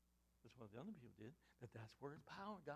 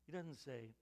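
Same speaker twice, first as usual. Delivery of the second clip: normal talking voice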